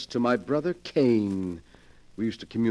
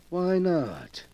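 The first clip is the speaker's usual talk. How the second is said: usual speaking voice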